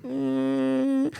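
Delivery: very slow, high hummed drone; imitated string drone. Imitating the film's eerie music with a long hum. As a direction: hum a creepy string undercurrent. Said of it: "like this undercurrent string".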